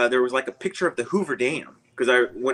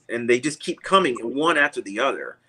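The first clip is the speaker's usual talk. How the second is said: ordinary talk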